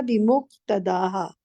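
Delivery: "बिमुक्तदाहा" slow, meem clear; sound the meem. Shown as a demonstration. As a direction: model "बिमुक्तदाहा" carefully. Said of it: "मीम miss कर दिया था".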